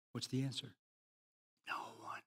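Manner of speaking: soft, barely voiced answer; hushed. Real, not acted. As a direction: drop to a near whisper. Preacher drops to hush.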